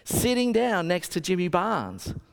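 ordinary talk, nothing marked

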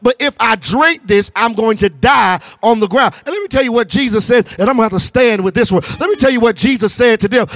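driving, rousing sermon delivery; full-force preaching. Real, not acted. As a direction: preach it hard and urge them to respond.

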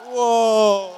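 loud, drawn-out whoa to the crowd; excited whoa. Real, not acted. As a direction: shout it out as you step up. Speaker takes stage after intro.

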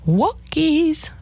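high, drawn-out sing-songy trainer's call; Barbara Woodhouse impression. Dog trainer calling out to her students, sing-songy. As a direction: swoop up high and stretch it out, sing-song. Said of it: "that little sing-songy voice".

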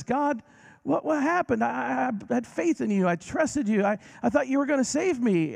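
high, stammering complaint; man in heaven asking God why; bewildered man. Retold or imitated. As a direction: plead confused at the gates.

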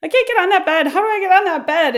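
high, loud mock panic; imitated tearful panic; crying, panicked. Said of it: "my voice, I'm, like, crying".

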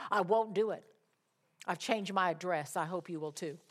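normal talking voice

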